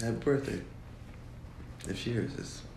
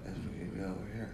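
softer, off-mic voice; away from the mic; 8 dB quieter, faster than usual. Shown as a demonstration. Guest testing whether the mic picks them up, away from the mic. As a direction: speak softly from back off the mic. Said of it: "you can't even hear me from way over here".

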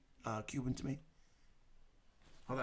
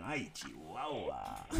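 very slow, softer drawn-out gasp; slow mock exclaim; 9 semitones lower, 8 dB quieter, much slower than usual. Host reacting to the role-play, slow and soft. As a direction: stretch it like a mock climax.

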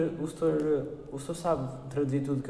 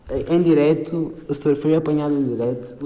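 mock reveal; 8 dB louder than usual. Exposing teacher live on podcast.

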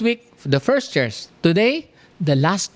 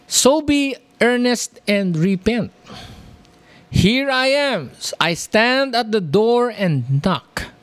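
scripture reading; 3 semitones higher than usual. Acted. Reads scripture aloud in sermon.

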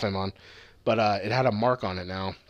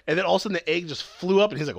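ordinary talk

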